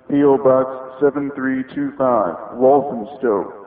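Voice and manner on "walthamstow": ordinary talk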